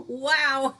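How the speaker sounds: loud, high wow with a laugh. Real, not acted. Host laughing at viewer's fainting story. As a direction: laugh it out in disbelief.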